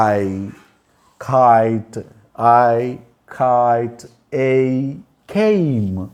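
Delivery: slow, low, stretched glides in kite and came; drawn-out demonstration. Shown as a demonstration. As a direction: hold each vowel of ఐ and ఏ for learners. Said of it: "ఇప్పుడు ఇది చెప్తాను చూడండి".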